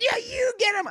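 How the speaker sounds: high squeaky egging on; mock cheering. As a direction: cheer the dogs on in a silly high voice. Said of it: "And he's like".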